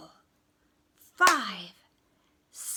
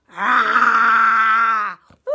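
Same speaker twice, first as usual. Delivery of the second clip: loud, long roar then a whoop; full-force roar; fed-up bear roar; 20 dB louder than usual. Acted. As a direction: roar like a bear who has had enough. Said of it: "he was fed up".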